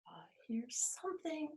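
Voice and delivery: breathy whisper, no voice; hushed secret whisper. Shown as a demonstration.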